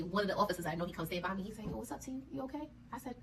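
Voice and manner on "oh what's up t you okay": soft, higher-pitched aside; discreet check-in. Retold or imitated. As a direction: quietly imitate a friendly officer checking on her. Officer checking on her privately.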